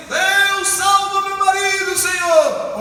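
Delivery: loud, high, slow pleading cry; crying out in prayer. Retold or imitated. Wife praying for her husband, loud tearful plea. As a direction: beg God to save him, full voice. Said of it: "E ela chorando pelo marido".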